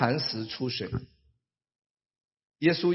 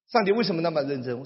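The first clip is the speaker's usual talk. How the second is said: ordinary delivery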